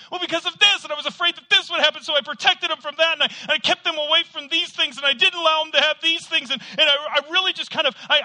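high, loud, rushed defending; fearful excuses. Retold or imitated. Parent excusing to questioner, high loud fearful rush. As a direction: blurt out anxious justifications. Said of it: "why aren't your children serving".

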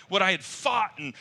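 normal talking voice

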